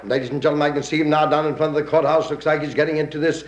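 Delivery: breathless radio play-by-play of a parade; parade radio announcer. Acted. Announcer describing the parade to radio listeners, eager broadcast voice. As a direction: sell the parade to the listeners at home.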